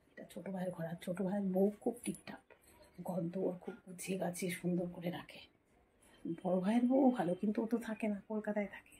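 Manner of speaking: hushed voice so the sleeping brother and father stay asleep; speaking softly. Real, not acted. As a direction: keep it low and soft, almost under the breath. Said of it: "আস্তে কথা বলছি".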